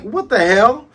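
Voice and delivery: high loud mock outrage. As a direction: snap it in disbelief. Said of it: "Usopp was like".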